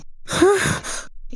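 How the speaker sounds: long breathy exhale; soft heavy sigh; exasperated sigh. Acted. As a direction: let out a weary huff. Exasperated in quarrel.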